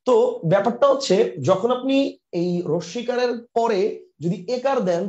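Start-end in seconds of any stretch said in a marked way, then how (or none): none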